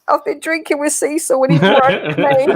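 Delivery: laughing. Retold or imitated. Retold drunken reply to wife.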